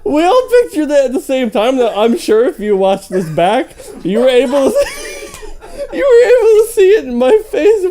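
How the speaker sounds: loud, high laughter, barely holding words; real uncontrollable laughter; laughing loudly. Real, not acted. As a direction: laugh so hard the voice cracks up. Reader laughing at the story.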